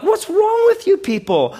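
very high, loud mock outrage; incredulous. Reader to Israelites complaining about food, incredulous. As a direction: let disbelief send the voice way up.

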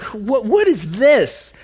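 astonished crowd's reaction, read aloud; amazed. A narrator's reading. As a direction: ask slowly in wonder. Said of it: "the people were all so amazed".

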